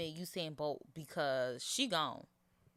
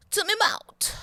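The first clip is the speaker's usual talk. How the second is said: put-on jokey accent; 9 dB louder than usual. Jokey phrase ending mic explanation.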